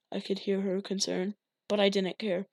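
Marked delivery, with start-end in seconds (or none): none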